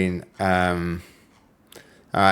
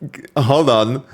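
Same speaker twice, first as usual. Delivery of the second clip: ordinary talk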